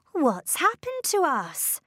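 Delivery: high-pitched, dismayed exclamation; alarmed cry. A narrator's reading. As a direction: cry out in bewilderment. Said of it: "she cried".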